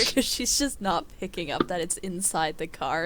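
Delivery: usual speaking voice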